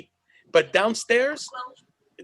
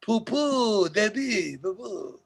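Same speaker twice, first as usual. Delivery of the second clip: silly mock French; much slower than usual. Mock sounds joking about French.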